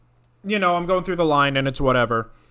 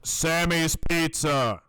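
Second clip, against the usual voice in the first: slow placeholder; much slower than usual. Shown as a demonstration. Placeholder name said slowly.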